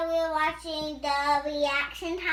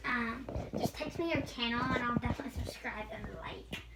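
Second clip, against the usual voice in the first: quick whispered aside; whispering; 3 semitones lower, 8 dB quieter, faster than usual. Real, not acted. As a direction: whisper to the viewers. Confiding aside to viewers.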